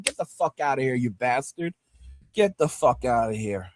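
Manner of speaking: low, quiet, cold kiss-off; low contemptuous dismissal. Real, not acted. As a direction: shut him out with contempt.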